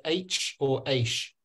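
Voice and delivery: H with a ch ending, Aish with sh; two pronunciations contrasted. Shown as a demonstration. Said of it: "how do you pronounce the letter".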